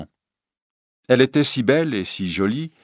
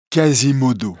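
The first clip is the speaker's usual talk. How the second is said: a louder, other voice for the hunchback; deaf bellringer; 4 semitones higher, 5 dB louder than usual. A narrator's reading. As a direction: answer simply, a bit louder. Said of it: "croyant que le prévôt lui demande son nom". (le bossu sourd, voix plus forte et différente)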